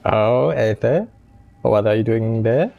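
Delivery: ordinary delivery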